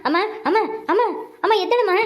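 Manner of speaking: tearful pleading. Acted. Son begs his unmoving mother to wake, crying. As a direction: plead through tears for her to wake up.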